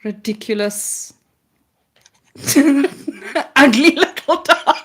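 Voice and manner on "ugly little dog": laughing dog tribute. Pretend. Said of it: "This is him in my imagination".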